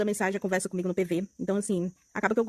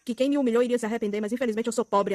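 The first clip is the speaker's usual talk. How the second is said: ordinary talk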